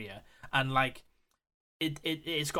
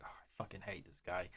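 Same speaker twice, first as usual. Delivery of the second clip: low, much softer mock grumble; villain's grumble; 7 semitones lower, 16 dB quieter than usual. Quoting the villain about his boss, low soft grumble. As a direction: grumble it low under your breath. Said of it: "he's like".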